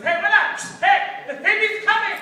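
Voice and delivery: very high, loud hammed-up warning; frantic mock shout; panicked uncle shout. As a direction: imitate an alarmed uncle yelling. Uncle warns of FBI coming.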